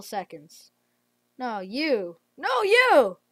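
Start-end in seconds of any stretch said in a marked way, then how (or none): 2.4-3.2 high, loud frantic cry; overwhelmed, shouting at the swarm; real frantic shout; excited outcry. Real, not acted. Swarmed by bots.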